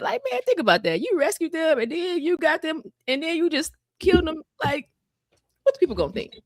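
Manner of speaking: high-pitched argumentative plea; pleading Moses. Retold or imitated. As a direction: reason with God incredulously. Voicing Moses arguing.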